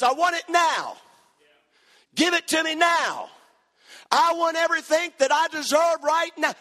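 high, insistent mock tantrum; demanding younger son. Younger son coming to his daddy, high demanding voice. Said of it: "Here's what he says".

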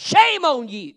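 high, loud condemning cadence; full-force sermon climax; fiery preaching. Real, not acted. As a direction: thunder it from the pulpit.

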